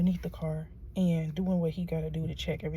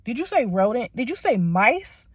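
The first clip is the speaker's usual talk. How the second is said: loud, higher, rising questions; raised-voice double-checking in disbelief; retold incredulity; 4 semitones higher, 9 dB louder than usual. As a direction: question it as if you can't believe your ears.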